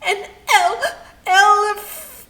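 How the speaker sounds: sobbing, high and loud; weeping. A narrator's reading. Storyteller voicing the weeping hippo mid-sentence, sobbing. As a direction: choke the words out through sobs. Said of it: "the weeps took over".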